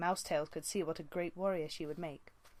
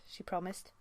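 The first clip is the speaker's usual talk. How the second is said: ordinary delivery, nothing marked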